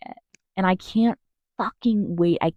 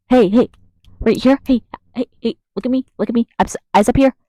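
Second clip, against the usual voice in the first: louder, higher scolding call for attention; 4 semitones higher, 5 dB louder, faster than usual. Pretend. Scolding an imagined adult commenter, calling to attention. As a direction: demand their eyes on you.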